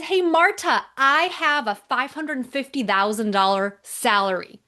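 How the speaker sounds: high, bubbly retelling of an excited client. Retold or imitated. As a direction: play him over the moon about his salary. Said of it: "he's very excited".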